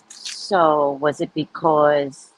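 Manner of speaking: slow, gentle, rising at the end; slow careful question. Retold or imitated. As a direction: ask her very slowly and gently. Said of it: "she'd be like".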